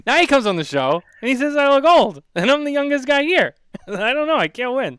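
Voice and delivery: high, loud, laughing mock protest; laughing complaint. Real, not acted. Laughing while protesting to Jose about being called old.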